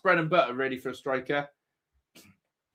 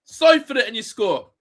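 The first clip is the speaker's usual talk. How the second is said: exasperated shout; 5 semitones higher, 6 dB louder than usual. Real, not acted. Ranting at missed chance.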